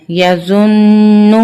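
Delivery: یظن very slow, the doubled ن hummed long through the nose; nasal ghunna. Shown as a demonstration. Teacher demonstrates the ghunna slowly for the children. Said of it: "ہم نے یہاں پر غنہ کرنا ہے".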